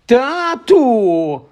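high yelled call to dad; sudden yell. Acted. Dudley bursting in on his parents with a high yell. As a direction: shout for Dad, pitched high. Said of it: "раптом зарепетував Дадлі".

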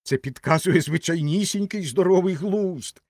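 high, emphatic assertion; mildly spirited exclamation; somewhat heartened. A narrator's reading. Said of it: "вже трохи піднісся духом".

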